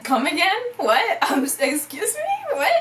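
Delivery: high-pitched disbelief with a laugh; incredulous. Retold or imitated. Teen told of her arrest warrant, incredulous. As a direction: play up the stunned double take.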